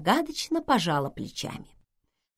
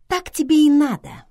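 high, loud, spiteful retort; venomous; 10 semitones higher, 8 dB louder than usual. A narrator's reading. Vivian answers Schweiger's complaint, venomously. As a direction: throw it at them with relish. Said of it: "ядовито сказала Вивиан".

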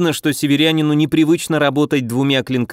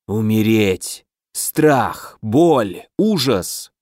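ominous inner voice; 4 semitones lower, much slower than usual. Acted. Mental attack whispers.

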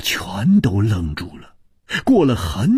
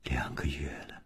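near-whispered low reply; very soft and low; subdued Liu Wumei; 10 semitones lower, 18 dB quieter, faster than usual. A narrator's reading. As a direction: answer faintly, almost without voice. Narrator voices Liu Wumei.

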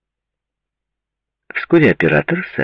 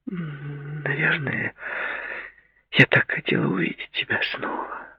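soft apologetic whisper; 6 semitones higher, 9 dB quieter than usual. A narrator's reading. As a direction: breathe it out guiltily.

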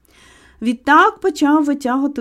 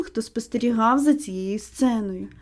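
ordinary delivery

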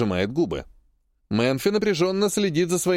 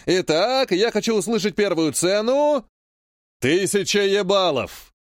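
hyping auctioneer; 5 semitones higher than usual. Acted. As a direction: whip the crowd into bidding. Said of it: "заливает вовсю Богучаров".

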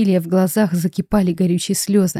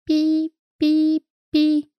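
high beeping bracelet noise; shrill beeping; 9 semitones higher, 3 dB quieter, much slower than usual. Acted. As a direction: squeak it like an electronic alarm. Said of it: "противный писк". (противный писк)

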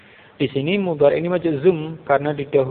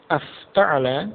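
"Afta'ala" slow, rising on the last syllable; questioning intonation; much slower than usual. Shown as a demonstration. Teacher shows hamzah istifham joined to the verb, questioning intonation. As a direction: draw out "Afta'ala" and lift the end like a question. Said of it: "seperti itu".

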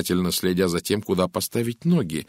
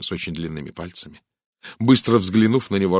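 usual speaking voice

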